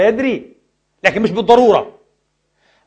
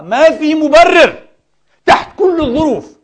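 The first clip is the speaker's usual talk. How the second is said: high, loud and slow, hammering each word; impassioned preacher's protest; emphatic outcry; 7 semitones higher, 5 dB louder, slower than usual. Real, not acted. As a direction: cry it out from the pulpit.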